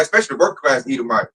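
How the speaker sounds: ordinary talk, nothing marked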